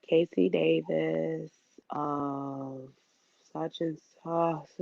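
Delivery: quiet, slow, shrinking intro read; barely audible nervous announcer; nervous mumble. Retold or imitated. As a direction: mumble the name like you're terrified. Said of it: "I was like this".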